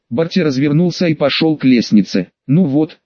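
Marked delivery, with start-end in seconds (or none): none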